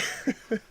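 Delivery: short airy chuckle; laugh. Real, not acted. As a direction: chuckle to yourself. Amused at own remark.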